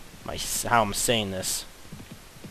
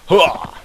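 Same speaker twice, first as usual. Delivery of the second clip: higher, louder hooah; loud gung-ho soldier; 6 semitones higher, 8 dB louder than usual. Pretend. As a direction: bark the battle cry. Soldier battle cry.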